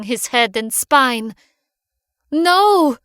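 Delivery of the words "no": high, loud cry of horror; anguished cry. Acted. Girl seeing her rat killed, loud anguished cry. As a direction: scream as the rat is crushed. Pet rat crushed by shovel.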